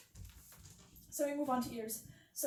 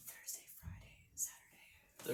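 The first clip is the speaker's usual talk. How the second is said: soft breathy whisper test; barely whispered, no voice at all; 10 dB quieter than usual. Shown as a demonstration. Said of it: "I'm just gonna whisper".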